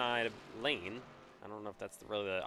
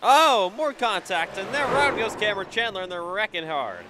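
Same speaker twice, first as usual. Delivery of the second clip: much higher, yelling race call; excited shout; 9 semitones higher, 11 dB louder than usual. Real, not acted. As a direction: shout the crash as it happens. Calling a hard wreck live.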